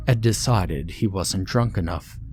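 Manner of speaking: ordinary delivery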